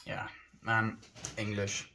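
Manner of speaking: ordinary talk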